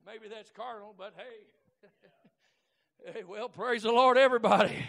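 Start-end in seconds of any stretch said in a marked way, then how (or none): none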